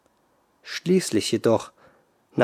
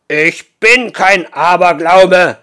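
louder, slower rumbling giant roar; rumbling shout; 6 semitones higher, 11 dB louder, slower than usual. Acted. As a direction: roar it through the hall. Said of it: "schrie der Riese mit seiner grollenden Stimme".

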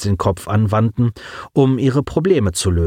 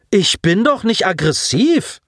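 high-pitched indignant protest; indignant outcry; 10 semitones higher than usual. A narrator's reading. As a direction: protest as if deeply insulted. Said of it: "empörte sich Colin mit lauter Stimme".

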